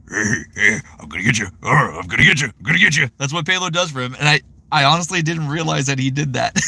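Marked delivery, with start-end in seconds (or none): 0.1-3.1 growly cartoon menace; mock-threatening character growl; Bob. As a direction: imitate a creeping attacker's growl. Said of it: "Bob's like".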